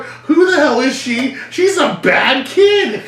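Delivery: very high, loud slow scolding; mock grandma scolding. Grandma scolding the aunt, very high mock scolding.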